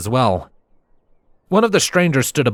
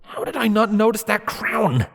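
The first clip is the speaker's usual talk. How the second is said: high-pitched exasperated self-rebuke; self-scolding; 12 semitones higher than usual. Acted. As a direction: scold yourself in a much higher pitch. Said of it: "I scolded myself".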